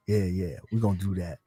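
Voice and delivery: low smug scheming voice; greedy boss voice. Retold or imitated. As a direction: play the greedy executive. Voicing greedy company, lower.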